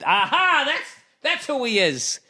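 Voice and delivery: very high-pitched mock accuser; mock gotcha. Accuser seizing on somebody's one wrong moment, mock triumphant gotcha. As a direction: pounce on it in a hammed-up high voice.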